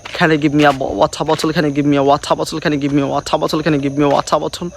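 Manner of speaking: quick repeated run in attempted British accent; tongue-twister drill. Shown as a demonstration. Practising British speech as a tongue twister, rapid repeated drill. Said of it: "It's one kind of tongue twister".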